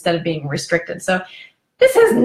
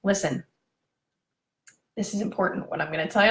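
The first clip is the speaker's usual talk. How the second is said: serious; 2 semitones lower, 7 dB quieter, faster than usual. Retold or imitated. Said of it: "really, really serious".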